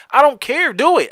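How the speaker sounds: loud, high, emphatic dismissal; exasperated insistence. Retold or imitated. Parent giving the schedule to the ex, exasperated. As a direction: push it out loud and fed up. Retold argument over visiting schedule.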